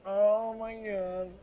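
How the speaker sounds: drawn out in disbelief; stunned drawn-out gasp. Real, not acted. Surrounded by zombies.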